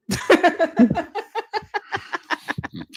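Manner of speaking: high, drawn-out real giggling fit; long laugh. Real, not acted. Host cracking up at the caller's greeting, laughing.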